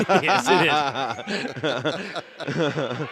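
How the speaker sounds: words through real laughter; laughing agreement. Real, not acted. Coach agreeing about make or miss league, laughing.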